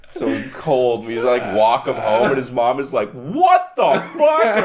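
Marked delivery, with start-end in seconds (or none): 3.1-4.6 slow, high, drawn-out mock outrage; a mother's slow shocked gasp at her hurt son; shocked mom. Mom at the door, seeing her hurt son, slow shocked high outrage.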